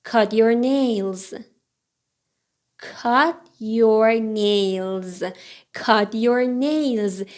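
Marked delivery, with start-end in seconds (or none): 2.8-5.2 slow, drawn-out learner's model; slow model reading. Shown as a demonstration. Teacher repeats the books sentence for learners, slowly. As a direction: give each word room, slowly.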